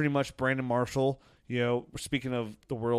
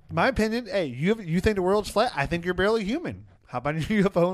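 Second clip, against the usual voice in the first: high, loud comic outrage; mock rant; 7 semitones higher, 7 dB louder than usual. Real, not acted. Host tells off flat-earth believers, loud mock rant. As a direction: sound off at them, raised and emphatic.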